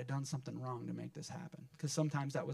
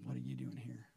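unspoken disapproval; 6 dB quieter than usual. Retold or imitated. Churchgoers silently judging an unwelcome man, quiet disapproval. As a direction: keep it under your breath, disapproving. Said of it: "give him those looks".

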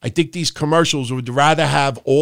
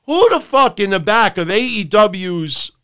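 ordinary delivery

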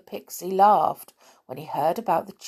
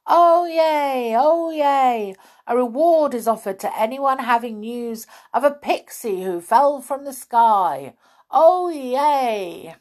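high, loud proclamation; loud crier's call; 6 semitones higher, 6 dB louder than usual. A narrator's reading. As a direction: cry it out like a town crier. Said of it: "crying loudly".

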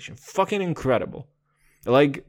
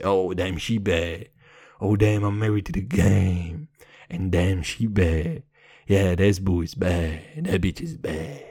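low, put-on deep voice; 7 semitones lower than usual. Host mocking his own swagger, deep put-on voice. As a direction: drop way down into a mock macho rumble. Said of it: "With my deep, deep voice".

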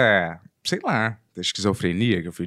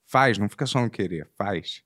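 ordinary talk

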